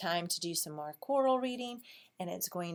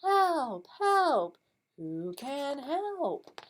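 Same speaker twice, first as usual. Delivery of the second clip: high, loud cry for help in singsong; duck calling for help; 8 semitones higher, 4 dB louder, much slower than usual. Acted. Teacher reads the stuck duck's refrain for choral reading, high calling voice. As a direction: call out high, stretching the last question. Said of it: "what does a duck say".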